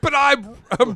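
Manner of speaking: high, loud outraged imitation; mock entitled protest. Mocking rich locals who got shot, loud entitled outrage. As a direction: whine loudly like an affronted local.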